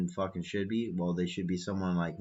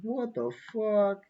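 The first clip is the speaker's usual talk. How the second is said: high, slow, baffled "what the"; mock the listeners' confusion, drawn out and high; confused listeners; 13 semitones higher, 4 dB louder, slower than usual. Imitating confused listeners reading the flyer. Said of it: "You guys are probably like".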